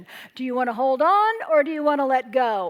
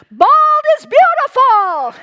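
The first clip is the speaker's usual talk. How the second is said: triumphant, loud and high proclamation; bold declaration; 11 semitones higher, 11 dB louder, slower than usual. Real, not acted. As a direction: proclaim it boldly to the room. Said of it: "declare as boldly and courageously as I could".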